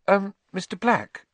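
put-on high, careful girl's voice; timid schoolgirl; timid Hermione. A narrator's reading. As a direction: ask shyly for a turn. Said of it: "said Hermione timidly".

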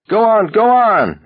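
high, loud taunting bellow. A narrator's reading. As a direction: roar the dare at him. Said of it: "Abraham bellowed".

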